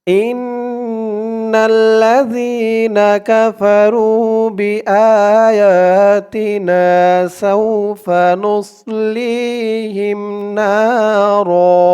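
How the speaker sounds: slow, melodic tajweed recitation; slow Quranic chanting. Shown as a demonstration. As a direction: chant each word long and drawn out. Recites the full verse.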